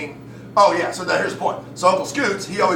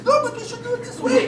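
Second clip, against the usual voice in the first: put-on very high German accent; hammed-up German nag; German inner critic; faster than usual. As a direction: fret in a squeaky German accent. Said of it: "inner critic is made of my German D N A".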